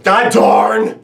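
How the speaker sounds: a loud, high, mock shout of a tame curse; tame outburst. Driver in a fender bender, shouting a mock tame curse. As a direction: yell the clean curse like it's a real one. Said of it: "and you look back to the other car and you go".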